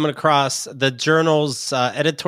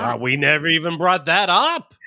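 mock disbelief. Mocks the article writer's reply.

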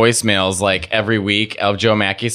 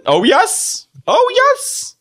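much higher sing-song oh yes; Wendy Williams impression; much slower than usual. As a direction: coo it like the talk-show host. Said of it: "when she talks".

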